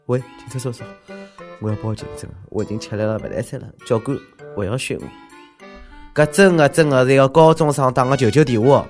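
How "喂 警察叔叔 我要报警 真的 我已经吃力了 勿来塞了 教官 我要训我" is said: quiet, weary whimpering plea; exhausted student. Acted. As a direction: beg the police tiredly. Voices exhausted student calling police.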